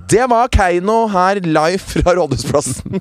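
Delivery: high, slow announcer sing-out; live TV presenter. Acted. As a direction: announce it live to a big crowd. Etterligner direktesendt programleder. (høy, utdratt programlederstemme)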